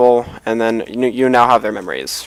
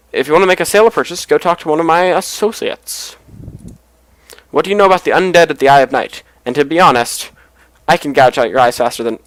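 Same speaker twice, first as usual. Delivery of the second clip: fast, louder, lightly acted grumpy boss; menacing clan leader; 4 semitones higher, 6 dB louder than usual. Game player voicing a clan leader warning a visitor, brusque and quick. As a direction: rattle off a brusque threat in character.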